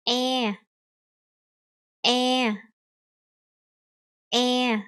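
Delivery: EH held level with stretched mouth; stretched vowel. Shown as a demonstration. Teacher models the new vowel, EH stretched and level. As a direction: closer EH, drawn out evenly. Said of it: "you need to stretch your mouth".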